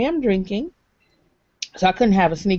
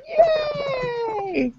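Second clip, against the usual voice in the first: very high, long squealed cheer; drawn-out cheer. Pretend. Host hyping the fun stuff to come, squealed cheer. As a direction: squeal it out in playful excitement.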